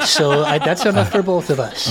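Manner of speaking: amused, laughing through the words; giggly agreement. Real, not acted. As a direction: let a laugh ride under the line. Laughing along with co-player.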